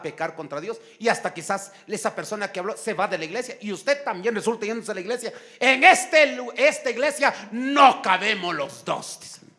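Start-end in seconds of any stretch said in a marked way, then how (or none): 5.6-9.2 loud, hammed-up ultimatum; mock indignant ultimatum. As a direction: declare it like a feuding church member. Acted quarrel between church members. (ultimátum indignado, actuado)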